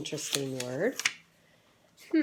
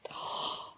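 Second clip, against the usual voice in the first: gasp; 7 dB quieter than usual. Acted. Turning to a surprising page.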